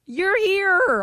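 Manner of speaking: high, loud sarcastic cheer; mock congratulations. Trainer mockingly congratulating a scared contestant, high and loud. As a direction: celebrate her failure, hammed-up. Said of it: "And I was like".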